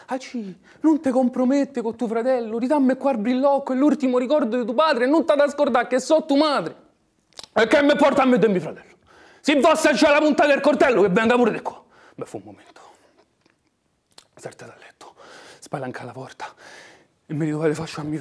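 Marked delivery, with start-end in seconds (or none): 0.1-6.9 high, loud pleading mother; desperate cry. Acted. Mother cries out in struggle. 7.5-11.8 loud, fast defiant yell; defiant shout. Acted. 12.2-15.1 whisper. Acted. Hushed tense narration.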